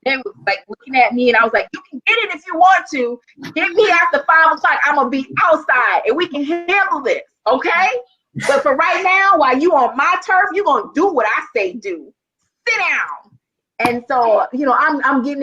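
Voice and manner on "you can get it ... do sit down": higher, firm street-tough challenge; tough warning. Retold or imitated. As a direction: stare down the boys who want to fight. Confronting boys squaring up to fight.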